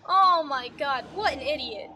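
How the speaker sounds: loud exasperated groan; exasperated outburst. Real, not acted. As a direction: vent disbelief loudly. Exasperated reaction.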